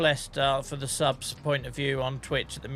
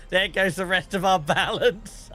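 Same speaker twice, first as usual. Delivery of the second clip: mock despair; 7 semitones higher, 6 dB louder than usual. Real, not acted. Just lost half his money.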